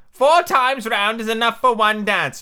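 high, slow Tweedledum, mildly winded; slow, high character voice, mock panting; panted out. A narrator's reading. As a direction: lightly feign being out of puff, pitched high. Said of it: "Tweedledum panted out".

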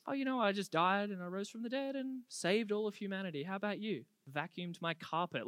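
soft, offhand mock small talk; nonchalant. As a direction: toss it off like an ordinary day. Nonchalant small talk between Jesus and friend.

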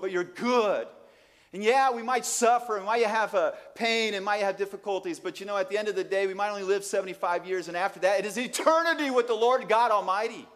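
loud, high, jubilant preaching with laughter; triumphant pulpit proclamation, breaking into laughs; preaching at full force. Acted. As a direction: exult over eternity with the congregation. Exultant preaching on eternity.

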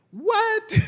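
very high, astonished squeak; incredulous high what; amazed what. Real, not acted. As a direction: burst out in sheer disbelief. Preacher marvels at God's power.

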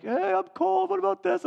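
put-on high, whining kid complaining; whiny cold kid. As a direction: squeak it like a freezing teen. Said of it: "I hear 'em like".

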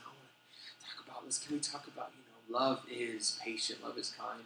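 hushed, breathy imitation of a couple; mock whisper. Couples asking for their wedding reading, hushed whisper.

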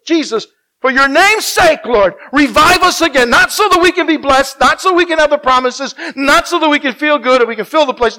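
loud, high, impassioned plea; full-force preaching. Real, not acted. Preacher pleading with God before the congregation, loud and high. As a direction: cry out for revival.

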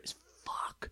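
whispered aside. Real, not acted. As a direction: say it under the breath. Unvoiced aside.

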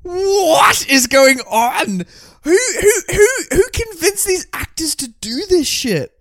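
real disbelief. Real, not acted. Disbelieving friend wails about the ridiculous film, high and pleading. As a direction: wail the question in real disbelief.

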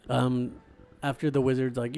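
ordinary talk, nothing marked

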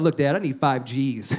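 low-pitched, matter-of-fact demand; mock-serious ask. Son asking dad for a large sum, mock-serious. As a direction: drop low for the big request.

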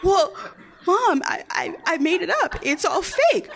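put-on high, halting voice of a cornered kid; pleading excuse. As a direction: stammer out a desperate explanation to mom. Child pleads with mother.

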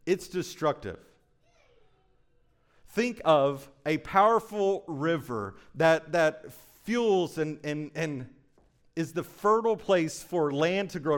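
loud, soaring preacher's call from the pulpit; full-force preaching. Acted. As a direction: preach it out big to the whole church.

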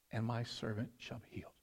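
humble plea. Acted. Centurion's humble plea, read softly.

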